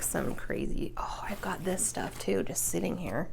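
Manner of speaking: half-whispered aside to herself; whispering. Real, not acted. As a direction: drop to a breathy whisper. Muttering to herself while searching.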